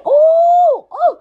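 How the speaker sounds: high, loud squealing ooh; gleeful squeal; excited shriek. Real, not acted. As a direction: squeal with delight. Shrieking reaction.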